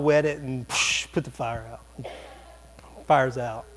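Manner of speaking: usual speaking voice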